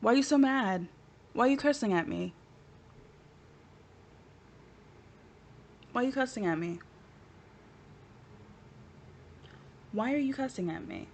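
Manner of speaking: soft, hushed bewilderment; small mock-wounded voice, trailing away; fading bewildered plea. Mock-hurt question repeated, ever softer.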